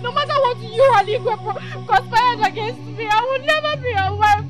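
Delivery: high, loud crying refusal; young bride shrieking she would rather die; tearful defiance. Acted. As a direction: scream it through tears at the old man. Bride refuses old chief, crying.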